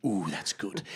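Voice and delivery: low, soft, satisfied sigh after a sip; Hugh Jackman impression. Imitating Hugh Jackman in his juice ad, low and satisfied. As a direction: sigh it out like a man savouring green juice. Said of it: "And he's like".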